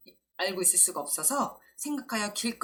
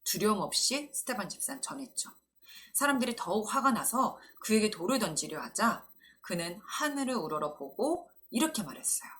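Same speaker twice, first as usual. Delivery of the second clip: ordinary talk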